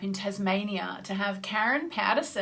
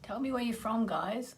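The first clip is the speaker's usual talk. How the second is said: ordinary talk, nothing marked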